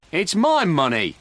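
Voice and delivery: loud, slow, high protest; insist, yelling; shouting. A narrator's reading. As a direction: Frank shouting at the sergeant. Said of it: "shouted Frank".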